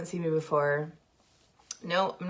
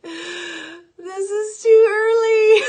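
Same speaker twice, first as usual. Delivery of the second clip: long, high, loud whine of protest; mock wail; 12 dB louder, much slower than usual. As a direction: wail it slowly like an exaggerated tantrum. Retold waking-up complaint, loud wail.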